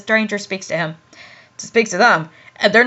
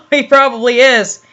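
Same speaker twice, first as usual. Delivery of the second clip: ordinary talk, nothing marked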